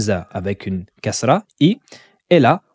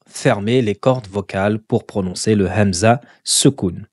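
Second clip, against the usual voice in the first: ordinary talk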